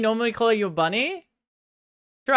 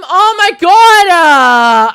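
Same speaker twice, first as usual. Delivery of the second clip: full-force outraged shout, drawn out; real yell; 9 semitones higher, 15 dB louder, much slower than usual. Real, not acted. Streamer yelling at chat over his stolen seeds, furious shout. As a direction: blow up at the screen, loud and long. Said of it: "I'm sorry I yelled".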